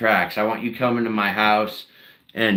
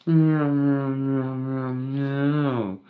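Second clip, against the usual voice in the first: mock meows; 3 semitones higher, much slower than usual. Meowing as the cat again.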